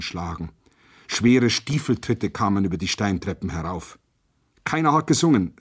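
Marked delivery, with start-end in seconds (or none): none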